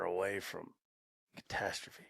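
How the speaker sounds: hushed, whispered punchline; ominous whisper. Real, not acted. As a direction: drop to a whisper on the word. Ominous punchline about store collapse.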